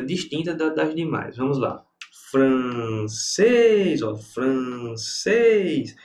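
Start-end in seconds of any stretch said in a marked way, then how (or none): 2.3-4.0 Francês with a long, drawn-out final "cês"; Francês split slowly, swelling on "cês"; stress on the final syllable. Shown as a demonstration. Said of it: "A sílaba tônica é essa". 4.2-5.9 francês very slow, with "cês" held long; francês stretched out to show the stressed "cês"; stress on the final syllable. Shown as a demonstration. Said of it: "A sílaba tônica é essa".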